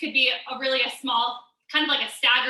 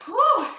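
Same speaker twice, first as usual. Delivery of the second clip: puffed-out whew, half laughing; breathy whew with a laugh; 8 semitones higher, 4 dB louder than usual. Real, not acted. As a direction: blow it out and chuckle. Coach blows out after a set.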